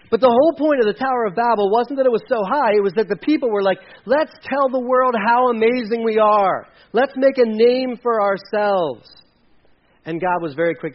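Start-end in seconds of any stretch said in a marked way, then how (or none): 4.1-9.1 loud, higher, drawn-out mock proclamation; imitated boasting. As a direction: proclaim it slowly like a self-important crowd. People boasting at Babel, voiced.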